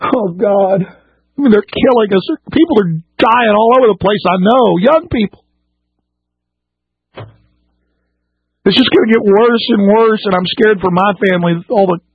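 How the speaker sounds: genuinely upset. Real, not acted. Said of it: "I'm genuinely upset right now".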